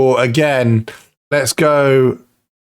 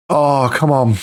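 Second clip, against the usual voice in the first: normal talking voice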